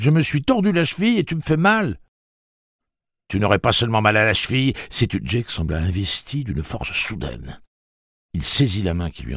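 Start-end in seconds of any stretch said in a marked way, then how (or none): 0.0-5.2 put-on higher boy's voice, a touch strained; a hurt teenager pushing back; lightly voiced boy, pained. Father and son argue.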